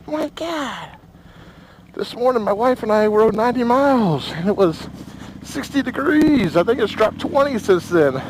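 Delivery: talking inside a helmet in the wind. Real, not acted. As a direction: voice through helmet mic on a ride. Riding with helmet-mounted camera.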